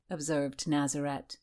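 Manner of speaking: usual speaking voice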